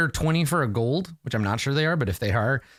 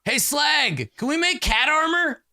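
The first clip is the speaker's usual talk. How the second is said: much higher, calling out to Slag; calling to the back; 13 semitones higher, slower than usual. Acted. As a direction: shout the question back through the shop. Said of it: "he's like".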